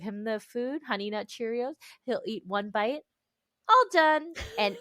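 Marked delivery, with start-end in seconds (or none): 3.7-4.3 put-on higher, louder child's all done; mock toddler announcing he's finished; kid saying all done. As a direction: chirp it like a toddler done eating. Kid voiced refusing food.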